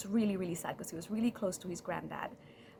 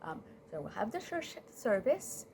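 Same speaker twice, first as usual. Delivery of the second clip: ordinary delivery, nothing marked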